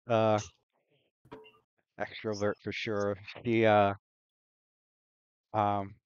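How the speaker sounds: usual speaking voice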